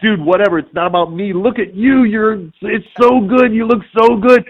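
high-pitched gushing fan; freaking out. Retold or imitated. Actor to his own cosplayer, freaking out. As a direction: gush at him, pitch way up. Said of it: "totally freaking out about this guy".

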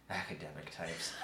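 low, soft grumbled aside; muttered low to another guard; guard's mumble. A narrator's reading. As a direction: mumble it under your breath to a companion. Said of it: "kind of mumble to the other".

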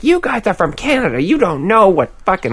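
mock angry trivia woman. Imitating the intense trivia woman yelling at them, high mock outburst. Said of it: "She's like".

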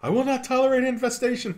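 high, loud indignant declaration; indignant proclamation. Pretend. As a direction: declare it like an outraged aristocrat. Mock proclamation after bugs.

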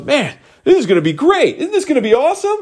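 high-pitched, gushing hype; enthusiastic stranger. Retold or imitated. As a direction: imitate an overeager race buddy. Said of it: "lose their enthusiasm for me".

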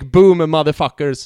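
loud but contained quote of a yell; punched, slowed-down mock shout; retold scream. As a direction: say it big and slow, without screaming. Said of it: "Jag skrek".

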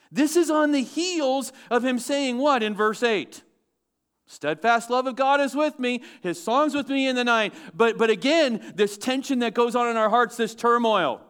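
high, loud pulpit preaching; preaching at full force. Acted. Preacher pressing the congregation, high and loud. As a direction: drive it home to the congregation.

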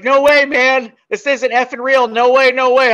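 loud, high frantic denial; imitated crying panic. Terrified friend refusing to believe it, loud tearful panic imitation. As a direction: wail it like a friend losing it. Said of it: "he starts crying".

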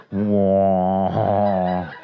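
very low, loud drawn wow; deadpan deep wow like a crowd; mock awed wow. Comic drops a deep booming wow.

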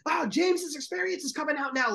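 high, pumped announcer; excited commentator. Retold or imitated. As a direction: call it with hype. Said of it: "you felt him get excited".

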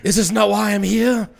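high, slow, laughing bellow; Gladiator line. Retold or imitated. Still quoting Russell Crowe, laughing through the slow bellow. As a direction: ham up the movie speech. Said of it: "And then he stood back".

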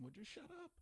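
high, held-back mock yell; retold yelling. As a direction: squeal it at the TV without real volume. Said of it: "watching this yelling at the T V".

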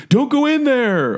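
slow warning to a horror character; mimicking a heckler yelling at the movie; mock theater yell. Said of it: "yell out something in the theater".

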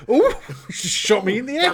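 high, loud comic astonishment; guard amazed at being shot, hammed-up; mock surprise. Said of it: "has a surprised look on his face".

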